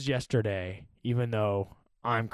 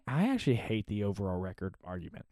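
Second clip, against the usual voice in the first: ordinary talk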